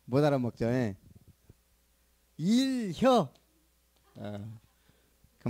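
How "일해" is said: short, higher Chungcheong command; Chungcheong dialect. Shown as a demonstration. 충청도 사투리로 짧게. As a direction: say it like a Chungcheong elder. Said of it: "세연이가 알아먹겠냐고".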